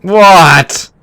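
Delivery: high, loud burst of surprise; astonished. Real, not acted. Host hears new watches measure pulse ox, astonished. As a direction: blurt it out in real disbelief. Reacting to news about new Garmins.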